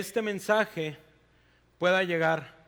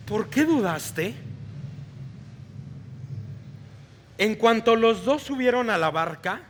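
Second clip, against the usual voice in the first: expressive storytelling read of scripture; dramatic scripture reading; Bible story narration; 2 semitones higher, 8 dB louder than usual. Acted. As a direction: read the Gospel like a vivid story to the congregation. Reads Gospel passage aloud.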